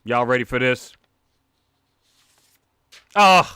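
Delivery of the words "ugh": usual speaking voice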